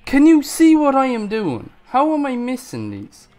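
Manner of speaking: loud, high exasperated yell; frustrated shout. Real, not acted. Player who keeps missing phantoms, frustrated shout. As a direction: shout in disbelief at your own misses.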